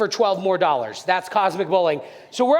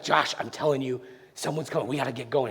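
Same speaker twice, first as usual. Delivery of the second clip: retold nervous kid, low and very soft; urgent hushed warning; 11 semitones lower, 7 dB quieter, faster than usual. Scared boy warns his friend someone's coming, urgent hushed voice. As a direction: warn your friend without being overheard.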